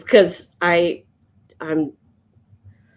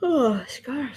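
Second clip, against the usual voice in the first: yawning; 5 semitones higher, 4 dB quieter than usual. Real, not acted. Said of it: "These yawns keep just kinda sneaking up on me".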